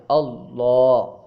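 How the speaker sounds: "اللہ" drawn out loudly with a thick dark l; full, heavy lam. Shown as a demonstration. Teacher demonstrates tafkhim, "اللہ" with heavy lam. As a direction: fill out the lam of "اللہ" and hold it. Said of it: "لام کو پر پڑھتے ہیں".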